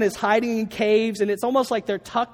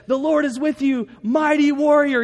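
angel's proclamation; 7 semitones higher, 6 dB louder than usual. Retold or imitated. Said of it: "the angel comes to him and says".

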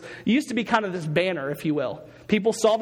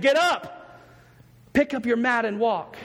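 yelled command; 4 semitones higher, slower than usual. Retold or imitated. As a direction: call it out like a command that lifts him. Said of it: "I think he yelled it".

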